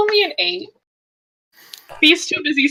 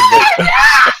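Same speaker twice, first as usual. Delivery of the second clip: high, screamed contradiction; shrieked denial; 3 dB louder than usual. Acted. Fee insists her friends live, shrieked denial. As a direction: shriek back in panicked denial.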